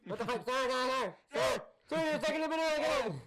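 high, loud, frantic mock hail; yelling police officer. Ocean police yelling at captain, hammed-up shouting. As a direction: shout over and over at the offender.

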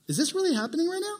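high, fast disbelieving question; can't believe your ears; mock incredulous. Hearing insult to your face.

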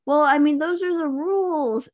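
high-pitched imitated excuse; mock helpless excuse. Acts out reply to upset boyfriend.

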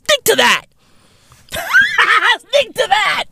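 high laughing squeal then yelled words; mock shriek. Vlogger shrieking at NASA's new name. Mocking shout at NASA naming.

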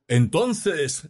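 high, declaiming pulpit word; resume the sermon in a lofty high voice; preacher. A narrator's reading. Priest carrying on his sermon, high declamation. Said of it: "seguía el orador".

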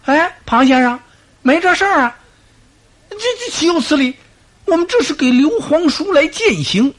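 very high, loud pleading whine; frantic denial. Acted. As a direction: squeal your innocence in panic. Accused generals deny the plot.

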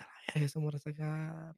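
soft unvoiced whisper. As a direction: whisper it like a secret thought. Retold thought, whispered.

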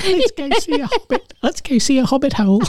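high, put-on New Zealand accent. Cohost showing his mock New Zealand accent, high. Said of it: "So that's now my New Zealand accent".

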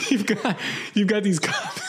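helpless giggles between words; laughing while talking. Real, not acted. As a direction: try to list it through real laughter. Cracks up while listing the cups.

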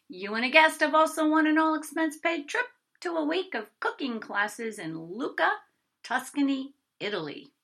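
loud, high sales-pitch cheer; cheerful caller. A narrator's reading. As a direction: sell the prize with a beaming phone voice. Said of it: "The cheerful voice continued to chatter".

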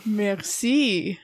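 Merci with a French accent, hammed-up; a quick mock-French thank-you; playful French merci. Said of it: "There you go, French accent".

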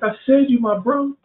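high-pitched repeated bro answer; Peter insisting. Retold or imitated. Pastor voicing Peter repeating himself to Jesus, high-pitched. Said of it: "He's like".

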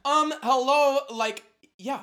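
loud, high mock-obvious hello; sassy duh, as if it's so obvious. Answering Jones with a loud, sassy duh.

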